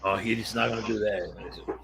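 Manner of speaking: ordinary delivery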